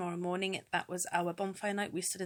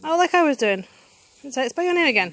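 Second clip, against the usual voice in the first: louder encouraging call across the garden; calling out outdoors; 8 semitones higher, 15 dB louder, faster than usual. Real, not acted. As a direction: call out over the fireworks noise. Calling out to child at sparklers.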